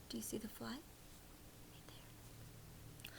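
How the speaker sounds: hushed aside, pointing something out; whisper. Real, not acted. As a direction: whisper so you won't scare the fly.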